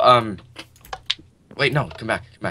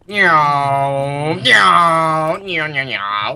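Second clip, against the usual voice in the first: cat meows; 3 semitones higher, 5 dB louder, much slower than usual. Pretend. Player meowing like a cat.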